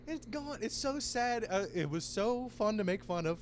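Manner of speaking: very high, squeaky lamenting voice; high-pitched playful grieving; mock lament. Real, not acted. Mock mourning of a haircut.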